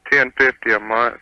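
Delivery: ordinary delivery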